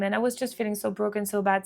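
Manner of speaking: usual speaking voice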